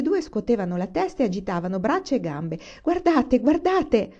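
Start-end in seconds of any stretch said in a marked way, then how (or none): 2.8-4.1 high, loud little cry; excited shouting. A narrator's reading. Grace watches the dancing figures, high excited shout. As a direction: cry out high, calling everyone to see. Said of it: "gridava Grace".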